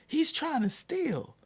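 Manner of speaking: high, slow imitation of an officer; accusing officer. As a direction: act out an officer accusing a child. Acts out police officer accusing him.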